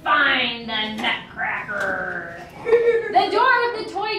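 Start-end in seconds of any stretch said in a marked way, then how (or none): 0.0-2.4 low, very slow villainous order; Mouse King's command. A narrator's reading. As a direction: draw out the order like an evil king. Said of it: "king of mice squeaked his command".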